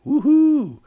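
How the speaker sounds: very high, loud whoop; joyous shout at the speed; exhilarated whoop. Acted. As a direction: let out a thrilled high whoop. Driver celebrates the cart's speed.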